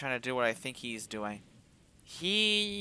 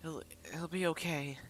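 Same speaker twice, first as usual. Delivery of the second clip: breaking whisper; 2 semitones higher, 8 dB quieter than usual. Acted. Whispering love into his ear, voice breaking. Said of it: "My voice breaks as all I can do is whisper".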